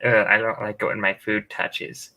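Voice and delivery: mock a fussy grown man; whiny picky eater. Picky adult at dinner, a lightly put-on whine. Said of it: "these are the same guys".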